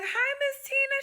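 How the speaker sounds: very high, cooing hello; acted sing-song sweetness; sweet greeting. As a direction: coo it to a beloved patient. Acts her greeting to elderly patient.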